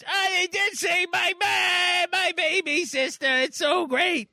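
put-on high-pitched elated kid; Mitch. Boy learns his ma and sister are safe, high joyful cry. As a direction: squeal with joy like a boy. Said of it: "And then Mitch says".

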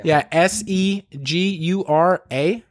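"S E G U R A" spelled letter by letter, slowly. Shown as a demonstration. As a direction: spell "S E G U R A" one letter at a time. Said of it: "Say it, say it slowly".

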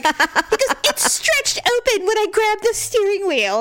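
high-pitched mock whine; complaining imitation. Husband explains cut hurts on steering wheel, complaining imitation. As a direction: plead the injury in an exaggerated high voice.

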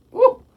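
high, loud excited whoop; a loud thrilled woo. Real, not acted. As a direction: let out a giddy woo. Swooning over [name].

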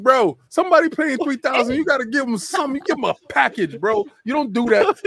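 high, laughing scold; indignant lecture. Retold or imitated. Retelling to the hosts how he scolded the shop guy, high and laughing. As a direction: lecture him, half laughing, for giving no package.

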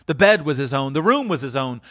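usual speaking voice

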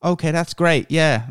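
high, light mock English accent; a hammed-up English host nodding along; bad English accent. Imitating the English podcast host, bad mock English accent. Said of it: "that was a terrible accent".

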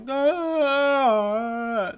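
groaning like a zombie. Pretend. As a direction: moan it out like the undead. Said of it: "That's my zombie right now".

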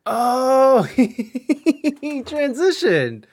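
high, drawn-out squeal of realization; delighted surprise. Real, not acted. Host reacts to Ralph becoming Rachel, delighted surprise.

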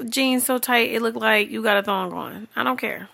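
ordinary talk

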